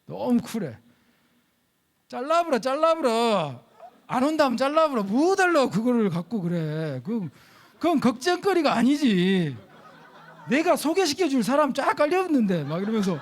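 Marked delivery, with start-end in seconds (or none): 2.1-5.0 high, loud, blunt pastor's order to cut her loose; tough pastor. Retold or imitated. Pastor tells the worried groom to just dump her, loud and high. 5.0-12.7 tough pastor. Retold or imitated. Pastor continues, laughing dismissively.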